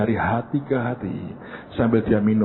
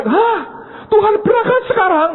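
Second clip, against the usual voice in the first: much higher, louder shocked question; startled; 5 dB louder than usual. Retold or imitated. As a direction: caught off guard, voice leaps up. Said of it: "Marta kaget". (kaget, suara melonjak tinggi dan keras)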